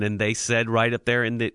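ordinary talk

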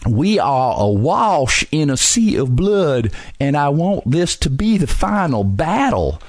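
put-on Robert E. Lee in thick Southern accent. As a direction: lay on a syrupy Alabama-style Southern drawl, slowly. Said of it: "plays him as perhaps some guy from Alabama or Mississippi with this real flowery, just kinda dripping, uh, Southern accent".

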